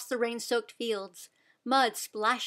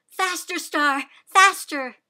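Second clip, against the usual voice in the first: higher, louder urging cry; shouting; 6 semitones higher, 8 dB louder than usual. A narrator's reading. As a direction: urge the horse on, building. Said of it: "then shouted".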